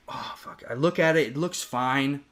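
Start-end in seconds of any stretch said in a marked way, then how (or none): 0.1-0.6 whispered curse. Retold or imitated. Retold reaction to his bleeding nose.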